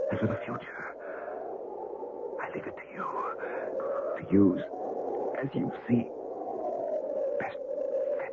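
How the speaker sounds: fading whisper. Acted. Dying man fading.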